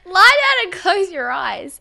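loud, high and slow instruction; teacher voice. Retold or imitated. Teacher guiding children into relaxation, slow, high and loud. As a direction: slowly talk the kids into closing their eyes. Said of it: "I was like".

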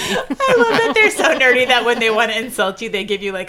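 talking through laughter; giggling while speaking; laughing. Real, not acted.

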